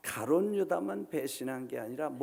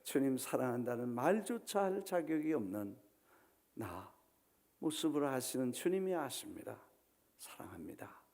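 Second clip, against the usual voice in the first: broken confession; 6 dB quieter than usual. Acted. Peter confessing to Jesus, fading to a whisper. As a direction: let it sink into a humbled whisper. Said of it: "베드로가 놀래서".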